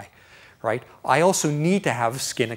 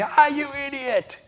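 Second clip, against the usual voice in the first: high, stretched-out cry of annoyance; drawn-out exasperated yell; brief outburst; 13 semitones higher, 3 dB louder, slower than usual. Retold or imitated. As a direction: yelp at the driver, then let it go. Brief outburst, then lets it go.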